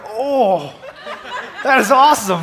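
loud, high, laughing gush; excited reaction. Retold or imitated. Vlogger recalls watching the poem, loud and laughing. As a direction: blurt it out thrilled and amused. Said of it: "I was like".

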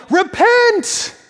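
high, loud yelled command; navigator's yell. Retold or imitated. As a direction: bellow it across the deck. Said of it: "this navigator would yell".

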